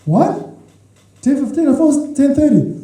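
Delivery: high, startled disbelief; mock surprise. Adult child shocked at curfew.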